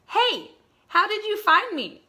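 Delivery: high, startled and loud; surprised squeak. Acted. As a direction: caught off guard, squeal it. Pete surprised, found by robot.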